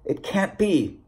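high, softened, shaken protest; stammered disbelief. A narrator's reading. Narrator faces the endless book, high soft disbelief. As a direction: pitch up and pull back in disbelief. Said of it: "I stammered, my voice hardly recognizable as my own".

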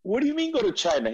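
high, fast disbelieving question; disbelief. Retold or imitated. Narrator questioning his friend, high quick disbelief. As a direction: fire back in disbelief. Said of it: "retold reply".

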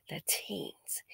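hushed ominous whisper. Acted. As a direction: breathe it out like a warning. Ominous punchline.

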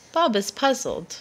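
plain reading voice

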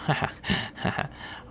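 soft breathy laugh under the breath; quiet unvoiced chuckle; whispered giggle. Real, not acted. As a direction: let out a hushed giggle. Whispered laugh after joke.

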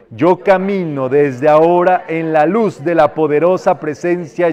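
loud, slow, ringing proclamation; powerful decree. Shown as a demonstration. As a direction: declaim it with full force, word by word. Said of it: "Podemos decretar". (decreto poderoso, lento y fuerte)

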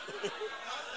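breathy burst of laughter. Real, not acted. Laughing at the congregation, a breathy laugh. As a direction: laughs at the memory.

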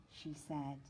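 plain reading voice

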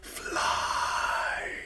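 eerie, drawn-out whisper; high thin crow voice. A narrator's reading. Crow urging a falling boy to fly, high thin whisper. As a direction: whisper it thinly, as a dream voice. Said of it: "The voice was high and thin".

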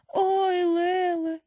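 high, drawn-out moan of dismay; soft wail.

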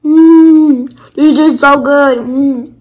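chewing while talking; eating a snack; mouth partly full. Real, not acted. Seen 3: Bit snack at -4; still holds it, mouth working while speaking.

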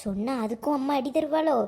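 low, soft aside to oneself; hushed worry. Acted. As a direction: wonder under your breath, nervously. Child wonders whether to tell father.

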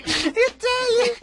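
high, loud stretched fake Italian; hammy Italian exclamation; mock Italian accent. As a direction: cry it out as a cartoon Italian.

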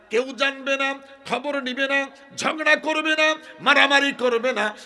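high, loud, rhythmic list; impassioned preaching. Acted. Preacher voicing Husain's words to grandfather, high-pitched fervour.

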